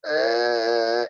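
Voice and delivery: loud, sustained pretend wail; hammed-up crying noise; mock crying. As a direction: burst into exaggerated bawling.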